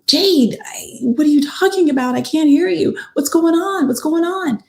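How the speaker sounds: high, panicky questioning; retold freaking out. Best friend answering the alarming call, retold as freaking out. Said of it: "And Nijae's freaking out".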